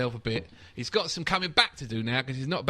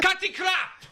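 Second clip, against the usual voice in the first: mock yell; slower than usual. Mock quarrel, imitated manager.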